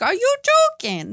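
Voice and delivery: high, sing-song Irish "Are you joking?" rising on "you"; hammed-up Irish accent. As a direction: mimic an Irish girl from Love Island. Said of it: "in her Irish accent".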